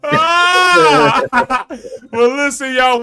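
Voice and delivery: high, loud delighted exclamation; excited outburst. Real, not acted. Host reacting to the laughter, loud delighted call. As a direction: whoop then call the audience.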